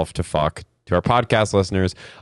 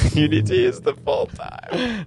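mocking echo; 12 semitones higher, 3 dB quieter than usual. Mocking cohost's instruction.

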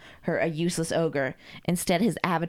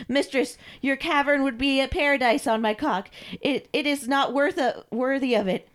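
higher, worshipful stammer; fawning submissive servant; 7 semitones higher than usual. Acted. Carl declares himself unworthy of his mistress, fawning. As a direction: flatter your mistress abjectly.

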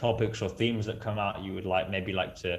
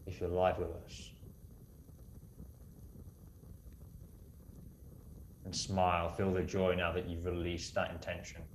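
guided meditation; 4 dB quieter than usual. Acted. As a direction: guide them gently into calm.

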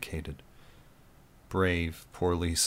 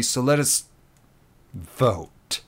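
disdainful; 6 semitones higher, 6 dB louder, slower than usual. Acted. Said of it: "the disdain was clear on Mike's lips as he forced out the word".

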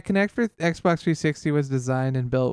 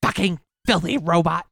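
mock angry yell; 5 semitones higher than usual. Yelling at the console.

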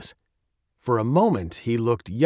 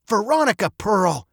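raised-pitch parental warning; stern chiding; 8 semitones higher than usual. A narrator's reading. Father hopes to end the conversation, sternly chiding. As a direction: use the full name to end the discussion. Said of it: "he chided".

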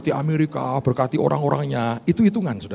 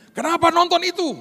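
high questioning officer. Retold or imitated. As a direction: play the suspicious immigration officer, pitched way up. Pastor voices the visa officer. (suara petugas imigrasi yang curiga, jauh lebih tinggi)

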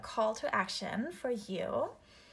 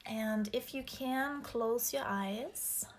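slow, whispered meditation voice; guided meditation; slower than usual. Acted. Leads grounding meditation.

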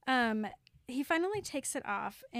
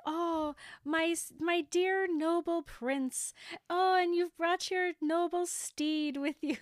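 lightly raised, slowed courtly princess voice; mock fairy-tale princess; 6 semitones higher, 4 dB louder than usual. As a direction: play it as a rehearsed, over-formal fairy-tale line. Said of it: "practicing all this weird fairy tale language".

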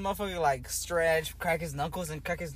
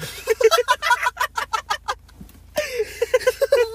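long squealing high laugh; high laughing fit; 8 dB louder than usual. Real, not acted. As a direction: burst into a helpless high-pitched laugh. Laughing at his own story.

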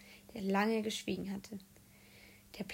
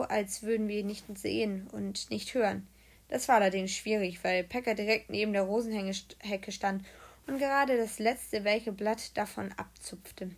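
usual speaking voice

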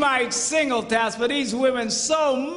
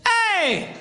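high, long falling holler; calling out; 9 semitones higher than usual. Retold or imitated. Husband calling to wife across the house, high acted holler. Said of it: "She yells back".

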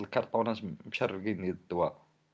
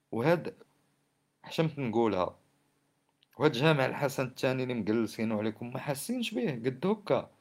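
ordinary talk, nothing marked

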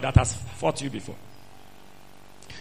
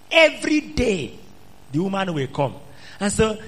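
normal talking voice

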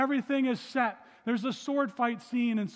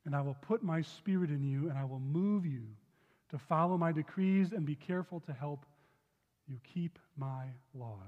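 measured, solemn Bible reading; scripture reading; 4 semitones lower than usual. Acted. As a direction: read the verse out to the congregation. Reads scripture aloud.

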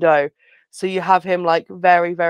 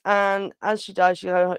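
ordinary talk

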